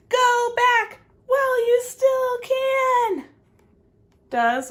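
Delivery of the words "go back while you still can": high, loud, slow ominous warning; slow and spooky, warn her away; rabbit's warning. Acted. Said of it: "Rabbit says".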